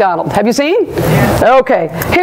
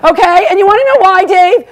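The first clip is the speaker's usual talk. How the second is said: mock outrage at the host; comic rant; 7 semitones higher than usual. Real, not acted. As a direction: fire it back at Dave. Ranting back at host Dave.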